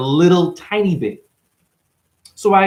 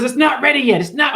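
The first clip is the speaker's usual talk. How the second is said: retold snapping; 9 semitones higher than usual. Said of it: "he was just snapping on me".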